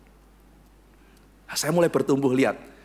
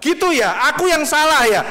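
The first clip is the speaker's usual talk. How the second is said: louder, higher, affronted retort; offended wife; 10 semitones higher, 7 dB louder than usual. Acted. Wife snapping at husband who blames her, affronted. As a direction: bristle back, wounded pride.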